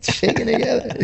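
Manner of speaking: a playful hint of PB saying his line; mimicking PB. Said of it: "P B says it all the time".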